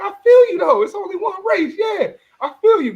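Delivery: high, sarcastic "I feel you"; mock sympathy. Mock reply to Sha'Carri.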